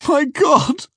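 high-pitched horrified outburst; anguished cry. Acted. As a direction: cry out in desperate disbelief. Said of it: "I cried".

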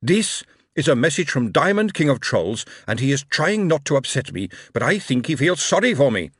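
starts even, rises to a loud, high shout; from measured news to angry address; calm turning angry. A narrator's reading. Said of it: "He raised his voice and shouted".

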